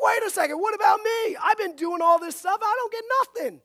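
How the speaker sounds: high, loud mock whine of grievance; cranky brother. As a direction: protest like a put-out brother, hammed up. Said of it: "he's cranky. He's like".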